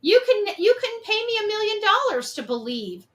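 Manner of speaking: ordinary delivery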